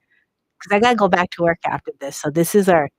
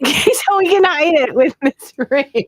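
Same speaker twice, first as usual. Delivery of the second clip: high-pitched, laughing delivery; 7 semitones higher, 4 dB louder than usual. Real, not acted. Host showing a ring to hide the bump, laughing. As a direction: talk through a burst of giggles. Breaks up laughing at own attempt.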